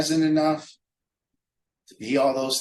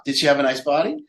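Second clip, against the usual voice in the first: usual speaking voice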